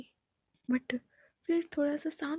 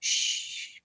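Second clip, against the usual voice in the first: sharp whispered shush; quick hushing sound; much slower than usual. Acted. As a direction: hush her urgently. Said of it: "कहती है, "श्श्श".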